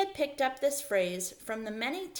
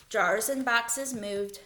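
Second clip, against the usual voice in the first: usual speaking voice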